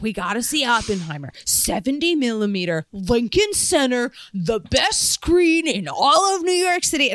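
lightly voiced eager film buff; a hint of a film snob boyfriend; imitating boyfriend. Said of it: "He was like".

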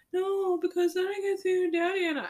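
whiny mock little-kid voice; protesting child. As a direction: mimic a small child protesting. Mom voices her son's protest.

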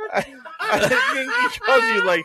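laughing. Real, not acted. Laughing through the words.

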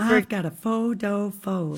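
light Southern accent on "I've got a photo phode". Shown as a demonstration. Repeating the actresses' Southern vocal coach phrase in the accent. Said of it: "the phrase they had to say over and over to get in their Southern accent".

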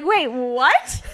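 loud, incredulous double take; mock-stunned outburst; stunned disbelief. Reacting to the boss already knowing, loudly incredulous.

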